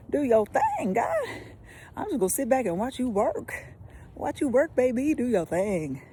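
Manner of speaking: root for God like a fan; cheering God on. Shown as a demonstration. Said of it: "cheering God on".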